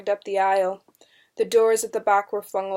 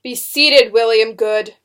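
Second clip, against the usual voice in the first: loud, commanding order from the bench; stern order; 10 dB louder, slower than usual. A narrator's reading. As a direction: order him down forcefully. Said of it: "Benjamin Fire ordered".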